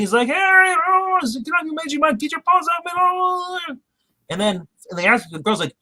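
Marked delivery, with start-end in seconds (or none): 0.3-3.6 very high squeaky panicked octopus. Retold or imitated. Retelling the octopus shouting at Harry, high squeaky. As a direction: squeal it like a tiny creature in trouble.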